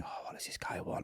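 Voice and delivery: suspicious whisper under the breath; whispered thought. Retold or imitated. Wary inner thought as a stranger runs up, whispered.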